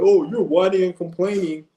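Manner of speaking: high, fast sneering imitation of a critic; mocking whiny critic. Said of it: "How you gonna sit there and tell me".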